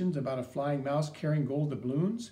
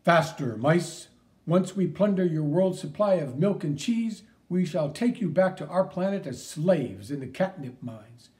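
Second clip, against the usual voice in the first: gloating cartoon villain; 5 dB louder than usual.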